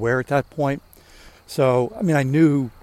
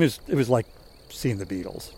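usual speaking voice

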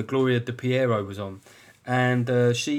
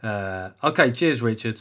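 normal talking voice

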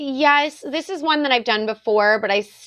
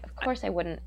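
low, hushed imitation British accent; quiet British reassurance, dropped low; 5 semitones lower, 12 dB quieter than usual. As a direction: do a soft, deep British voice. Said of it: "in his British accent".

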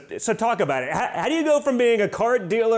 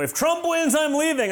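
mock liberal; 9 semitones higher than usual. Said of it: "all the liberals in sixteen".

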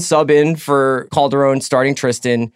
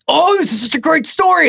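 mock gushing. Mock gushing fan quote.

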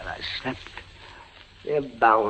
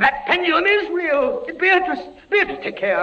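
high, urgent warning cry; alarmed warning. Acted. Prisoner warning his wife about the pendulum, high and alarmed. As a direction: warn her in rising alarm.

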